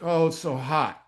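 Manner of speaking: high, slow mock whine about the heat; whiny complaint. Kid at hot conditioning, mock whining to coach. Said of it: "one of the kids would complain".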